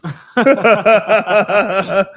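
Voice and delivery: loud, rapid cackle; loud laugh. Real, not acted. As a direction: burst into a long staccato laugh. Host cackles at own remark.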